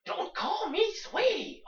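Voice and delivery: high, slow, loud snippy retort; mock snippy woman. Woman on a dating site refusing to be called sweetie, high and slow. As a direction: snap it like an offended woman online. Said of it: "it wasn't even like that".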